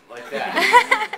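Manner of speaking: a sudden, very high and very loud laughing squeal; squealing yelp. Retold or imitated. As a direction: let out a shrieking yelp of delight. Showing his own reaction on set.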